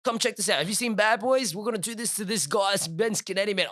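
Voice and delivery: high-pitched retold angry rant; worked-up anger. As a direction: call a mate over, acting all fired up. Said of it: "I'm so angry".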